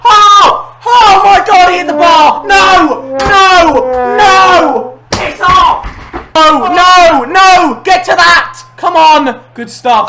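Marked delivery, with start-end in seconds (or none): 0.0-5.8 loud, frantic no's; panicked shouting. Real, not acted. Rival hits the bar. 6.3-9.4 frantic shouting. Real, not acted. Tense moment in match.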